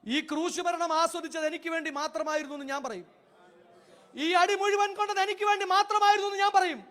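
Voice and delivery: loud, rising preacher's proclamation, each line hammered; fervent preaching. Acted. Preacher proclaims Christ's suffering to congregation, loud fervent declaration. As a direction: preach it out full force to the congregation.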